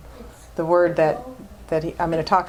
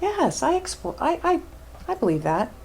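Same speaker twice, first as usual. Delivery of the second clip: squeaky high stammering yes, yes, trailing off soft; a hint of a nervous, unconvinced churchgoer; mock half-hearted believer; 10 semitones higher, 5 dB quieter than usual. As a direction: play the doubter who claims to believe. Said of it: "sit out here and say".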